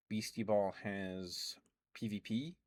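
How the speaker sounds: ordinary talk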